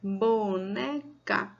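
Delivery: slow, split into "bo-ne-ca"; syllable by syllable. Shown as a demonstration. Teacher counting syllables for children, syllable by syllable. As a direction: draw out each syllable of "boneca" with gaps. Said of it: "Você levantou quantas vezes o seu dedinho".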